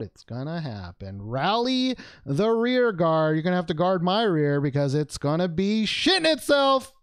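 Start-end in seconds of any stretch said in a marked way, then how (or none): 1.2-6.9 loud, high hype before the big reveal; rally the troops, loud and raised; building hype, pushed high and loud; hyped-up rallying cry. Pretend. Hyping up the pack before reveal.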